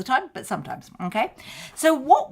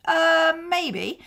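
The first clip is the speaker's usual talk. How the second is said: hesitant maybe; 10 semitones higher than usual. Retold or imitated. Imagined prospect's hesitant reply.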